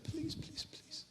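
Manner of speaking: high, hushed begging; inward whispered plea. Acted. Boy begging inside as wolves close in, whispered plea. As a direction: whisper it as a desperate silent prayer. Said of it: "he was saying this inside".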